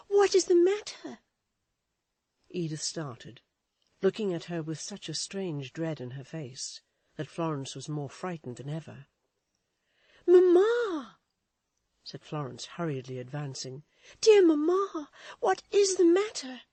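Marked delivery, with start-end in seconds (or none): 0.1-1.2 high, loud anxious question; frightened cry. A narrator's reading. Florence frightened at her mother's strange face. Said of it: "she cried". 10.3-11.2 put-on high, loud girlish call; girl's anxious call. Florence hurrying toward her frightened mother. 14.2-16.6 put-on high, loud girlish call; girl's anxious call. Florence hurrying toward her frightened mother.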